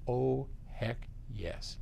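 ordinary talk

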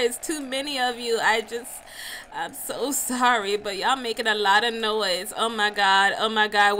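normal talking voice

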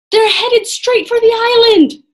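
loud, high warning; urgent alarm. A narrator's reading. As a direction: shout the danger up to her. Jack shouts alarming news.

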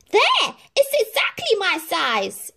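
very high, loud triumphant stepsister; smug declaration. Acted. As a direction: insist the tiny shoe fits. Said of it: "she declared".

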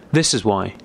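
ordinary delivery

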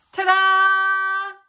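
high and loud proud reveal; excited ta-da. Acted. Friends showing off their drawings with a loud, high ta-da. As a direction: announce it like a magic trick.

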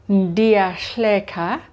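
ndiyahleka said slowly, syllable by syllable; teacher drawing out ndiyahleka; slow model pronunciation. Shown as a demonstration. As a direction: spell out ndiyahleka slowly for learners. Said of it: "I am laughing".

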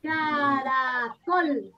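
very slow, syllable-by-syllable "Caracol"; sylabizowanie. Shown as a demonstration. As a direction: stretch each syllable of "Caracol". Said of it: "Ese es el silabeo".

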